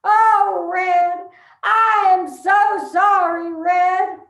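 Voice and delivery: loud mock sobbing plea; mock drunken bawling. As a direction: ham up a weepy drunk at the casket. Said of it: "bawling over Red Daddy's casket".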